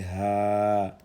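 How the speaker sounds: "ha" held very long and level; lengthened vowel. Shown as a demonstration. Teacher showing alif lengthening, "ha" stretched out. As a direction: draw "ha" out on one steady note. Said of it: "sert d'outil d'allongement".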